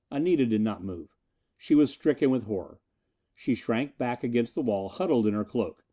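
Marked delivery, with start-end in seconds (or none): none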